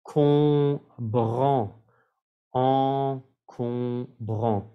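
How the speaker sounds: Encombrant very slow, three nasal vowels. Shown as a demonstration. Teacher modelling nasal sounds in Encombrant. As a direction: linger on each nasal in Encombrant. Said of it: "tres sonidos nasales".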